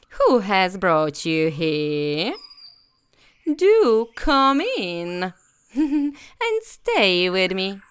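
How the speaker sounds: high, slow cackling crone voice; a sweet-sounding, sly old hag; old witch. Acted. Old witch luring the children inside, slow crone voice. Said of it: "and said".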